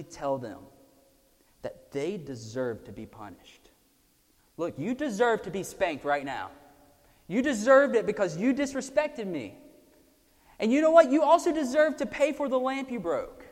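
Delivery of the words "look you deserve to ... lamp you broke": building from firm to loud and high; stern scolding parent. Pretend. A parent scolding the child over the broken lamp, building to loud. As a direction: scold the child, rising to a peak.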